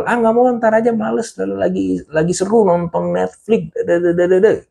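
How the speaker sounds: high, whiny no; mock-whiny teen brushing off mom's request; grumbling refusal. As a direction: grumble like a lazy kid refusing chores. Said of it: "lu menolak sambil ngomel-ngomel".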